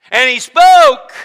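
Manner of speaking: loudest, highest slow proclamation; booming proclamation. Acted. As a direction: peak the build with full voice. Preacher booming climax.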